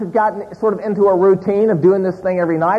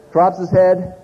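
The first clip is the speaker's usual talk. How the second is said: usual speaking voice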